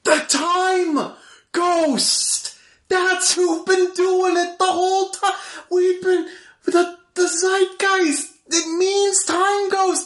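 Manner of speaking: manic excitement. Acted. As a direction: rave about the discovery wildly. Manic realization.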